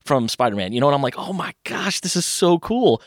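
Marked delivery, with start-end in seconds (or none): 1.2-3.0 high-pitched, drawn-out awe; enamored kid. Retold or imitated. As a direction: gush like an amazed little kid. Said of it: "I was enamored by it".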